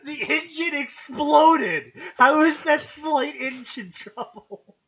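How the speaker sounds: incredulous yell at the absurd line; outraged laughing shout. Real, not acted. Mocking the captain's announcement.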